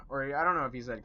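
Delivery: ordinary talk, nothing marked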